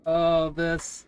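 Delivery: usual speaking voice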